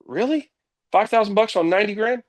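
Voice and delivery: high-pitched, incredulous retort; retold disbelief. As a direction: act out disbelief, pitch jumping up. Retold disbelief at boss's bonus offer.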